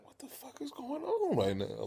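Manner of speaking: acted stunned falsetto; bewildered. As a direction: squeak the question in mock bafflement. Retold bewildered reaction to the crying.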